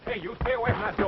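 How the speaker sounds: high, urgent call; alarmed warning. Acted. Man warning someone away from the door, alarmed. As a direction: call out a warning, pitched well up.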